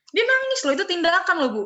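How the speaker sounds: high, loud insistence; acted-out indignant arguing; insistent student protest. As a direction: plead your case to the teacher, pitch high. Retold argument with the teacher.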